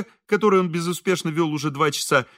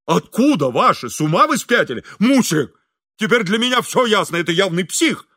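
outraged; 5 semitones higher, 5 dB louder than usual. A narrator's reading. Engineer outraged at priest's claim.

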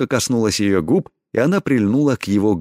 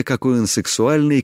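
plain reading voice

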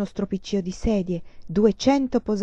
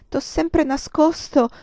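slow, high, halting admission; a higher, slower first try; halting confession; 7 semitones higher, slower than usual. A narrator's reading. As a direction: drag out the secret she kept. Hesitant confession.

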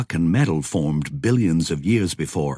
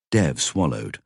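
ordinary delivery

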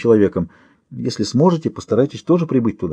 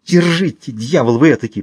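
hissed; 3 semitones higher than usual. A narrator's reading. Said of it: "прошипела она".

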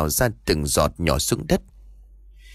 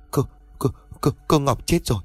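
a mild, read scared stutter on cô; gently shaken servant reporting a death; frightened stammer; 4 semitones higher than usual. A narrator's reading. Servant tells the parents Ngọc is dead, mild stammer. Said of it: "nét sợ hãi mà trả lời".

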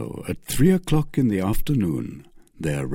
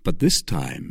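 plain reading voice

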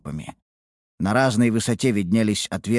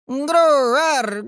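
high, slow kitten-like roar; beast roar; 4 dB louder, much slower than usual. Acted. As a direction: try a monster roar but squeak. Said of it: "изобразила она звериный рёв".

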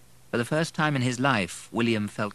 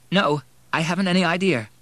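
higher, louder, distressed reply; upset woman; 10 semitones higher, 6 dB louder than usual. A narrator's reading. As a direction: answer with upset in the voice. Tearful Anne on the phone.

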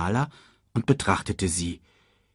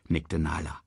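plain reading voice

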